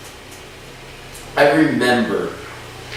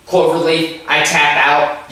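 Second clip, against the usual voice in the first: ordinary talk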